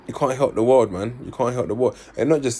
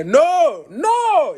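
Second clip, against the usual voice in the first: mock French-accented no, much higher and louder; French accent yell; 5 dB louder than usual. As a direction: imitate panicking French siblings shouting no. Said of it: "they got the French accent".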